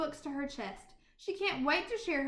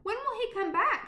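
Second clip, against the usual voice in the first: higher, louder pleading question; child nagging mother, higher; eager child; 8 semitones higher, 5 dB louder than usual. Acted. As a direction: ask like an impatient little girl. Anna pestering again.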